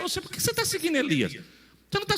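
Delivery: very high, fast and squeaky mock question. Preacher mimics the prophets' sons pestering Eliseu, squeaky and fast. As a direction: squeak it out like a nagging busybody.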